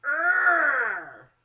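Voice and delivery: loud high strained roar of pain. Retold or imitated. Gripping the bed rails during the ultrasound, a loud pained roar.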